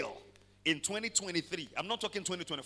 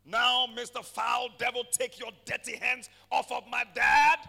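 louder, higher, forceful orders to the devil; commanding rebuke; 7 semitones higher, 10 dB louder than usual. Shown as a demonstration. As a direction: command the devil to let go of your family. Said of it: "He responds to commands".

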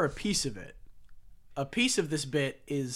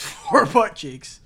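laughing; 7 semitones higher, 14 dB louder, much slower than usual. Real, not acted. Laughing at own joke.

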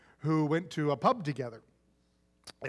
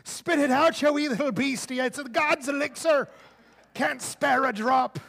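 put-on much higher and louder Irish accent, slowing at the end; 9 semitones higher, 5 dB louder than usual. Irishman shaking the fly for his Guinness, in a loud Irish accent.

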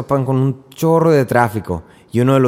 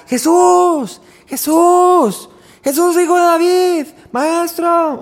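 much higher, louder pleading cry from far off; desperate cries; 3 dB louder, much slower than usual. Acted. Mother crying out to Jesus for her daughter, desperate high shout. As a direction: call out to Jesus over a crowd. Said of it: "Y está grite y grite y grite".

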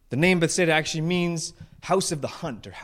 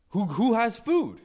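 slow, high-pitched, loud demand; mock loudmouth calling round the boat; loudmouth Peter; 7 semitones higher, 4 dB louder, slower than usual. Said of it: "He's the loudmouth".

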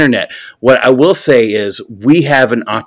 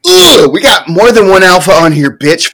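louder, higher mock trash talk; mock alpha; 7 semitones higher, 5 dB louder than usual. Ribbing his co-host about alphas, higher and louder mock trash talk. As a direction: bark it like a joking alpha.

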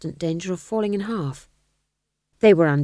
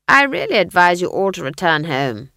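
Granny commanding; 8 dB louder than usual. A narrator's reading. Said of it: "said Granny Weatherwax".

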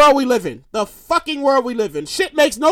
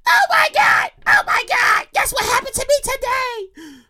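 high-pitched, breathless-with-news squeal; excited finder; 8 semitones higher, 3 dB quieter than usual. Acted. Woman bursting to tell her family, excited. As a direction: act out a thrilled woman, voice pushed way up.

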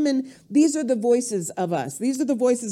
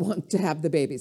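ordinary talk

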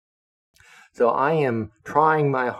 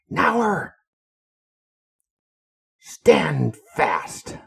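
retold captain's shout; 6 semitones higher, 4 dB louder, much slower than usual. Captain barks at passing sailor.

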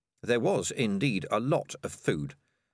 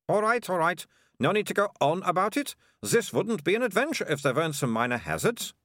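lightly voiced impatient young girl, higher; bossy girl; 9 semitones higher than usual. Melissa dismisses Keith from the trapdoor, lightly voiced bossy girl. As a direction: brush off the complaint like a know-it-all girl.

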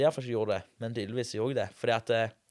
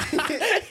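laughter. Real, not acted. Laughing at friend's remark.

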